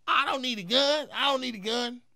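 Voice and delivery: loud, high-pitched mock boast; Cat Williams. Comedian mimicking his armed rival backing off, mocking high voice. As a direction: imitate a rival's squealed, blustering denial. Cat's retold boast in standoff.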